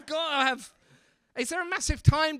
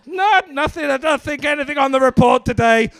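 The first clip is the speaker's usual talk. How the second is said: loud, higher put-on dismissive voice; mock-confident denial, pitched up; imitating oblivious guard; 5 semitones higher, 12 dB louder than usual. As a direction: play a guard loudly brushing it off. Voicing John's report reply.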